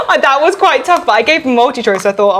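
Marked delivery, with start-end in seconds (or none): 0.1-1.1 laughing. Real, not acted. Laughing while speaking.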